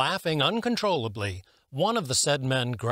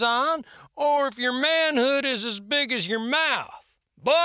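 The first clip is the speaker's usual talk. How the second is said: a booming, good-humoured sergeant ribbing his friend; jovial sergeant; 11 semitones higher than usual. A narrator's reading. Hornsby's voice in the story.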